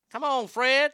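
lightly voiced call to a dog. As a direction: coax the dog along. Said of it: "there has to be a Fred the dog".

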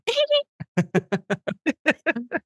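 high-pitched giggle. Acted. Impression of a singer for the co-hosts, high giggle. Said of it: "That's my [name] impression".

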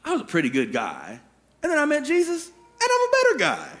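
very high, singsong chirp; chirpy parody of a pleased believer; mock self-satisfied. Believer telling himself he is a good guy, mock self-satisfied.

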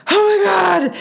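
high-pitched mock exasperated cry; mock-dramatic kid wail; imitated kid's outburst. Kids' outburst while parent stands back.

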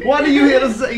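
laughing shout. Pretend. Louder repeat, shouted.

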